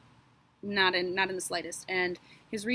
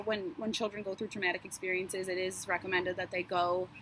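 ordinary delivery

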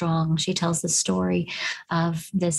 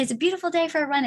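quick, high, cheery greeting; re-enacted sunny small talk; big-smile greeting; 8 semitones higher, faster than usual. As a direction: toss a friendly remark to someone as you run past, smiling big. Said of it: "I'd smile really big".

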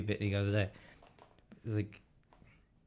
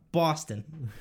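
higher broad Boston; Boston Irish accent; 5 semitones higher, 4 dB louder than usual. Pretend. Boston Irishman naming his great grandparents' home, Boston accent. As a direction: answer in mock Boston. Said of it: "the Boston Irish one's funny as well".

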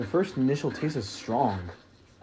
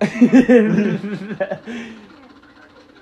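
loud laugh; 11 semitones higher, 12 dB louder than usual. Real, not acted. Laughing at broke joke.

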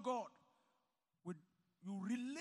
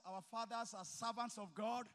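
normal talking voice

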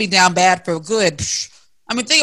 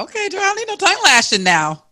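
playful shout; 11 semitones higher than usual. Real, not acted. Reacting to a rant, laughing.

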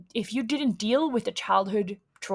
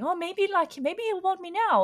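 higher, hopeful little voice; cling to a wish of a reply; wishful hoping; 9 semitones higher than usual. Retold or imitated. Texting the ex again, hopeful and much higher. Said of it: "send him another message saying".

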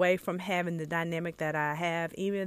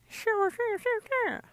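playful rapper voice for fellow creators; 14 semitones higher, slower than usual. Retold or imitated. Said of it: "Got a little [name] in there".

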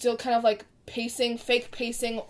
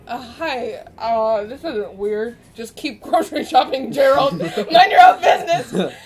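laughing through forced casual lines; awkward laughing; 10 dB louder, slower than usual. Retold or imitated. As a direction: pretend nothing is weird, giggling. Acting awkward grocery shopping, laughing.

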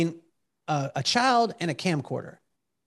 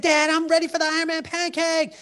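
put-on high child's voice; little son; 11 semitones higher than usual. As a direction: answer like an eager little boy. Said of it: "He said".

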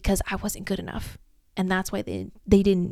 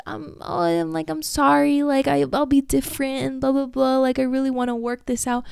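higher, whiny mock apology from a crawling-back ex; mocking ex begging back; 6 semitones higher than usual. Ex begging to come back after mistreating her, mock high whiny apology. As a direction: lightly mimic the groveling ex. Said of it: "to come crawling back after all this time and be like".

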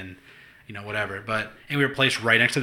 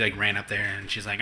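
ordinary talk

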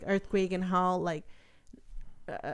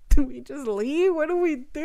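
scared; 7 semitones higher, 4 dB louder than usual. Retold or imitated. Mother asks the local lady whether to flee, frightened. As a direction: ask it like you're about to cry. Scared question during tsunami alarm.

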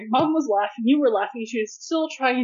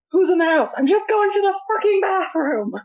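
startled dad; 8 semitones higher than usual. Retold or imitated. Dad voiced yelling in night.

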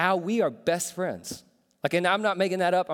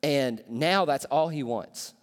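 ordinary talk, nothing marked

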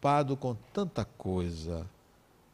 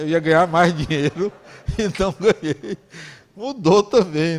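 laughing through the punchline, louder; 2 semitones higher, 7 dB louder than usual. Real, not acted. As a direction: chuckle it out as a joke on yourself.

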